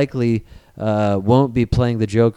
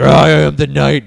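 mock Batman, louder and gruff; growled Batman line; 8 dB louder than usual. Imitating Garfield's Batman, louder and grave.

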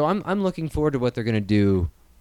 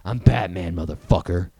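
gravelly Batman; 6 semitones lower than usual. Retold or imitated. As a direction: lower Batman growl. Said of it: "he goes".

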